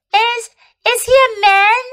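put-on high, hesitant young girl asking; a small girl, a little unsure. Lucy asks, child voice.

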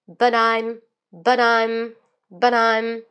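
slow, loud "but I'm" linked as "budaim"; American flapped T. Shown as a demonstration. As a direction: link BUT I'M with a quick D sound, repeated slowly. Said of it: "美音会把T做后一D". (but I'm 美音连读，T 读成 D)

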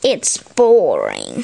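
slow, low grumble; bored, drawn-out grumble; grumbling. A narrator's reading. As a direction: complain in a low, bored drag. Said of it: "he grumbled".

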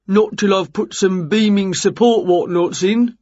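workman character voice; builder Jerry, matter-of-fact. A narrator's reading. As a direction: put on the voice of a builder.

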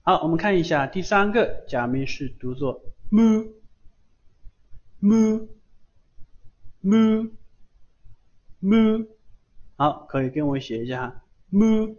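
teacher slowly drilling mu, mu, mu; slow model reading. Shown as a demonstration. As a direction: stretch each mu long and slow like a kana drill.

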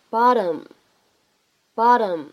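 "bottom" with the tt as a quick tap, "bah-dum"; American flap T. Shown as a demonstration. Teacher models American "bottom" with flapped tt. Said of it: "它是一个闪音的处理".

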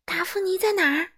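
worried. Acted. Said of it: "巴豆担心地问".

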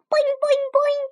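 very high, loud boing; boing like an excited frog. A narrator's reading. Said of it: "Og added".

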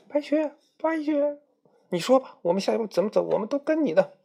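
very high, eager calling; 急切高喊白雪; eager followers. Acted. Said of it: "站起来说". (尖声请示的姐妹)

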